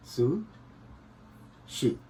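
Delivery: Sushi stretched very slowly and soft; slow demo word. Shown as a demonstration. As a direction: draw out sushi syllable by syllable for the class. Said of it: "तो sushi, सु". (Sushi को बहुत धीरे खींचकर बोलना)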